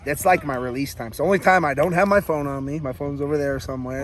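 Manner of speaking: ordinary talk, nothing marked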